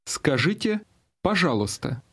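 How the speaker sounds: ordinary talk, nothing marked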